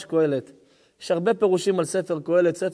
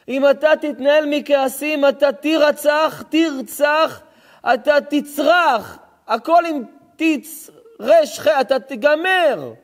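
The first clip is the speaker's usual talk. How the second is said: slow, loud, higher sermon cry; preaching at full force; 5 semitones higher, 5 dB louder, slower than usual. Acted. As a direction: drive the verse home from the pulpit. (דרשה בקול רם ואיטי)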